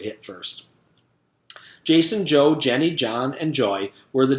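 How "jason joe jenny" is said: usual speaking voice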